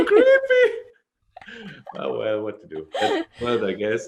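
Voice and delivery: amused, giggling; laughing. Real, not acted. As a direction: laugh through the words. Laughing at creepy sales voice.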